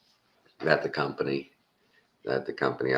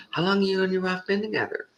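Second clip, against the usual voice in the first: very high, fast, friendly question; chatty salesman; faster than usual. Retold or imitated. Salesman asking the caller about his marriage, very high and quick. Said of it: "he's like".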